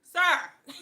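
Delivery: loud, high, exasperated Sir. Real, not acted. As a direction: call him out sharply. Scolding her son, exasperated.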